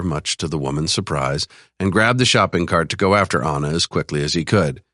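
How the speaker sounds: ordinary delivery, nothing marked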